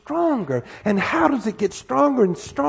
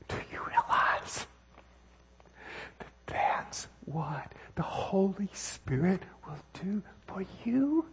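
hushed whisper; 12 dB quieter, slower than usual. Real, not acted. Preacher whispers key point to congregation.

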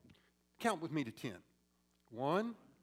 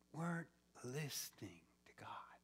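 fading whisper, much softer; 9 semitones lower, 13 dB quieter, faster than usual. Real, not acted. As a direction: let it trail off in a whisper. Sermon ends in whisper.

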